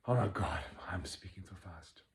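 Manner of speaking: a real unvoiced whisper to herself; whisper aside. Real, not acted. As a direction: catch yourself and whisper it under your breath. Aside to herself mid-talk.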